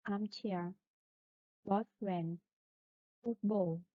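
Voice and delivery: put-on "armchair", "boyfriend", "football", each with the main stress on its first part. Shown as a demonstration. Teacher giving compound noun examples, "armchair", "boyfriend", "football" stressed on the first part. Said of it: "is main stress on the first part".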